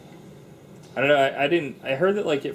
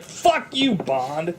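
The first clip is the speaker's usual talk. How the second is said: mock outburst; 11 semitones higher, 4 dB louder, much slower than usual. Mock retort at Bond.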